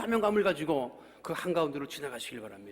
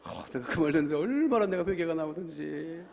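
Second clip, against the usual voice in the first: ordinary talk, nothing marked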